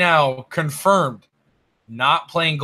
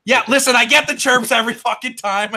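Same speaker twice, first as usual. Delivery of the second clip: higher, louder exasperated protest; exasperated outburst; 7 semitones higher, 4 dB louder than usual. Real, not acted. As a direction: push back loudly at the teasing. Defending himself to teasing friends.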